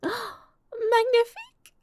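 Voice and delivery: high, slow gasping French delight; delighted exclamation. Acted. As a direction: marvel with a loud gasp. Delighted gasp over crepes.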